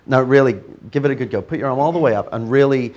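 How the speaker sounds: loud, firm coaxing; loud urging. Real, not acted. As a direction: push him to try harder. Urging volunteer to resist.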